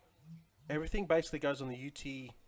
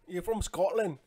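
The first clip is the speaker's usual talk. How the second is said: a quick, hammed-up Scottish accent, higher and louder; bad Scottish accent; 6 semitones higher, 6 dB louder than usual. Teasing a Scottish viewer in a bad Scottish accent. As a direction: have a go at a Scottish accent and miss. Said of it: "That's a bad accent".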